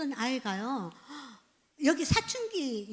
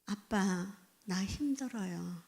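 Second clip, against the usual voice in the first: put-on child confessing hurt; 6 semitones lower, 4 dB quieter, slower than usual. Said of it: "마지막에는 뭐가 나오느냐면".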